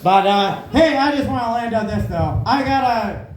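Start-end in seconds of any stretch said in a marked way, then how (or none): none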